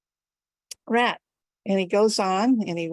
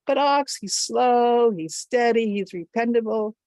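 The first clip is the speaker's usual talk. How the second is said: louder, higher, measured list of virtues; emphatic endorsement; 4 semitones higher, 4 dB louder than usual. A narrator's reading. Goat making the case for Ox, emphatic and raised. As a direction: press each point home.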